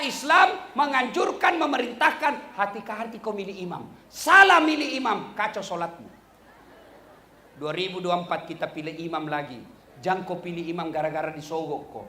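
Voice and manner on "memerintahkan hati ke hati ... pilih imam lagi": usual speaking voice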